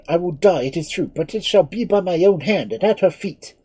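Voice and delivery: high, loud, raging defiance; a prisoner raving against his fate; maddened outburst. Acted. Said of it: "driven to madness by the thought".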